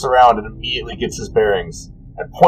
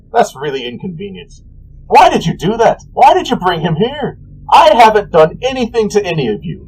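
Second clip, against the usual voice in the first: petulant god; 8 semitones higher than usual. Acted. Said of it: "he goes".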